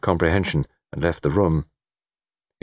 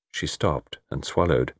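ordinary delivery

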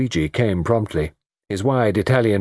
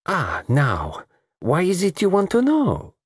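coquettish, pitch sliding high; playfully suspicious accented woman; arch; 11 semitones higher, slower than usual. Acted. Lola teasing the detective about his question, arch. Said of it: "Lola was arch".

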